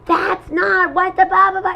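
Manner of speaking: high mock yell of the upset friend; retold yelling. Retelling a friend yelling after oral surgery, high mock yell. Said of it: "she starts yelling".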